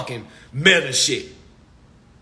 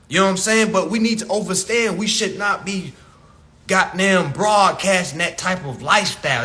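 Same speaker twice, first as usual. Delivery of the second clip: ordinary talk, nothing marked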